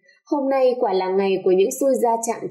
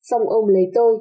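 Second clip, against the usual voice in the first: ordinary delivery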